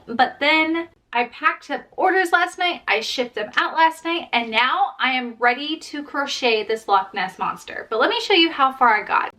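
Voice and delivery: ordinary talk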